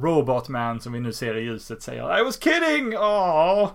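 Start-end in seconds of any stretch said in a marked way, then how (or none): 2.2-3.7 high, loud exasperated cry; protesting yell. Retold or imitated. Robot Man calling after the terrified kids, pleading yell. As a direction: yell it after fleeing kids, much higher.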